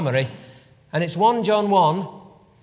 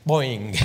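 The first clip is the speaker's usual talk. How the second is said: playful low cartoon boing with a laugh; comic boing; 6 semitones lower than usual. Preacher mimics Peter bouncing, playful boing. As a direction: make the bounce noise for fun.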